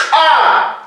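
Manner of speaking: "A" as ejective k'a, with a popped k; sharp glottal release into the vowel "A"; glottalized consonant. Shown as a demonstration. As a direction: pop a glottalized k before the "A".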